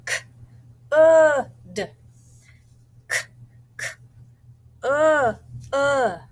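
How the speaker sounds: k, uh, d said sound by sound, stretched; teacher sounding out the word "could" for spelling. Shown as a demonstration. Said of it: "I'm gonna do my first part".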